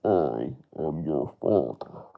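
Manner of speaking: the dark lord revealing the truth to his son; Darth Vader impression. Shown as a demonstration. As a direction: speak with slow, heavy menace. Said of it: "a Darth Vader".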